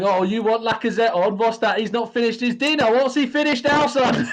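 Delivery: high, loud mocking taunt; rowdy jeering pub banter. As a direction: jeer like a rowdy fan. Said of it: "who was that person in the chat box going".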